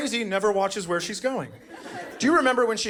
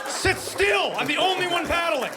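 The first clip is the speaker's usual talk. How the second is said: much higher mock shout; yelling at kids; 13 semitones higher than usual. Father yelling at kids in the canoe, much higher.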